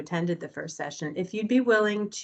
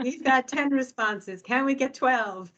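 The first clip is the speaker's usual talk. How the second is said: higher, slow auctioneer call; 7 semitones higher than usual. Pretend. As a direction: call for more bids like an auctioneer. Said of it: "I feel like an auctioneer".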